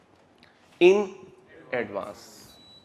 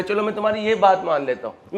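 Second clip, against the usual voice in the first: ordinary talk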